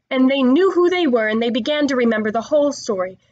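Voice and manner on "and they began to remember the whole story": ordinary delivery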